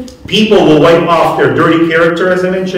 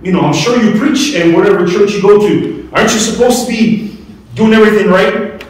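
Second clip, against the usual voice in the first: higher, accusing question to a man of God; indignant young lady; 6 semitones higher than usual. Retold or imitated. Preacher quoting the upset young lady, higher and accusing. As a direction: voice her hurt accusation, pitched up. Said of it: "But it bothered her".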